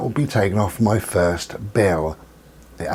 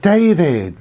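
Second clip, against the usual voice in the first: mock delighted; 8 semitones higher, 4 dB louder than usual. Greeting agent by name, laughing.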